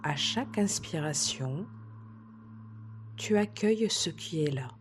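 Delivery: slow, soft relaxation voice with long pauses; soothing sophrology session delivery, softly paced; guided meditation. Acted. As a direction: guide a listener gently through calm breathing, unhurried. Leads a breathing relaxation exercise.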